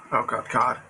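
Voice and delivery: ordinary talk, nothing marked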